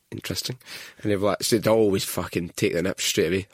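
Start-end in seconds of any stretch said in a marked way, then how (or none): 0.1-0.6 softly insinuating; quiet knowing. Pretend. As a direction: drop it quietly, loaded. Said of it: "I would then go".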